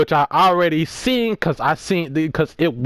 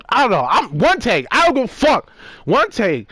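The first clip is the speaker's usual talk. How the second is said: defiant; 6 semitones higher than usual. Real, not acted. Defiant about one-take video.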